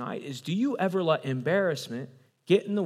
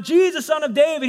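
high, loud excited shout; acted crying out; 11 semitones higher, 7 dB louder than usual. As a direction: call out eagerly over a crowd. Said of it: "He's crying out".

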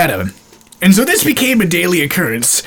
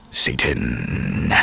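whispered low and breathless; 8 dB quieter than usual. Retold or imitated. Whispering Satan back to his friend in class. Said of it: "And so I went".

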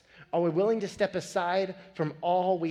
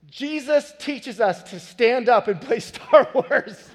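slow, higher, laughing through it; earnest child; 8 semitones higher, 10 dB louder, slower than usual. Retold or imitated. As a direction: repeat it as the kid, cracking up. Son repeats, dad laughing.

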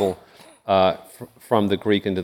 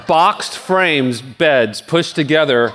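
laughing; 5 semitones higher than usual. Real, not acted. Laughing about bed and pictures.

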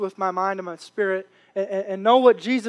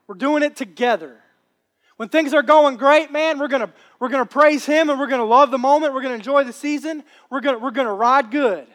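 louder, higher preaching build; fired-up preaching; 3 semitones higher, 3 dB louder than usual. Acted. Preacher rousing the congregation, louder and higher. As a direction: preach it with rising fire.

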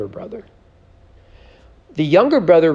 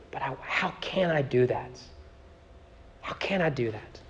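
hushed pleading whisper; 12 dB quieter than usual. Acted. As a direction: drop to a whisper, heartbroken. God pleading, voiced in sermon.

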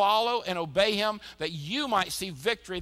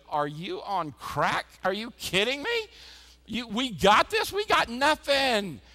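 incredulous scoff; 5 semitones higher, 6 dB louder, slower than usual. Retold or imitated. Everyone scoffs at Samantha.

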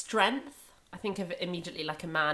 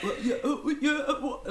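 lad voice; 9 semitones higher than usual. Retold or imitated. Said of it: "girls do lad voices, they're always like".

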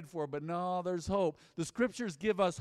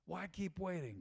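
much softer, almost under the breath; soft repeat; 10 dB quieter than usual. Acted. Pastor repeating to the congregation, hushed and softer. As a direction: let the echo fall quiet and searching. Repeated question, dropped to near hush.

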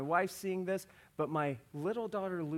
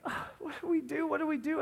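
high, flustered repetition; dad at a loss, imitated; fretting in a raised pitch; mock parental panic; 8 semitones higher, faster than usual.

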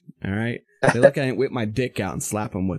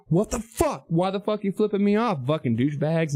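high, indignant replay without shouting; retold angry challenge; 7 semitones higher than usual. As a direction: act out confronting rude drivers. Acting out the roadside confrontation.

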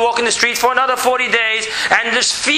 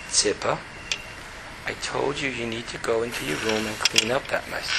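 much lower, softer, calm and even; tone brought down; 8 semitones lower, 11 dB quieter than usual. Shown as a demonstration. Father telling his daughter to clean her room, low and calm. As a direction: keep it low and steady, no yelling. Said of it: "bring your tone really down".

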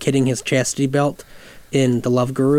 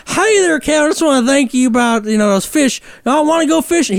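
much higher over-the-top Southern hillbilly voice; 10 semitones higher than usual. Retold or imitated. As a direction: play the cheery hillbilly uncle big. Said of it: "over-the-top Southern hillbilly caricature".

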